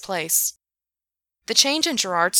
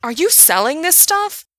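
outraged question; 10 semitones higher, 6 dB louder than usual. Acted. Gerard accusing Alex.

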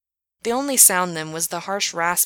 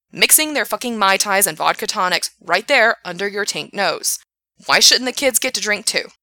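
angry rant; 2 semitones higher, 6 dB louder than usual. Acted. Frank ranting at Gerard.

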